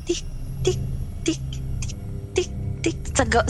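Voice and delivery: soft breathy tik tik clicks; imitated ticking sound. Telling a friend the eerie night noise, soft ticking clicks. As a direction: click out the tapping noise at the window. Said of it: "nakakarinig ako ng, ano, ng".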